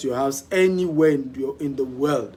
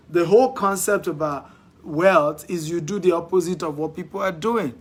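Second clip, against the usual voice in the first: usual speaking voice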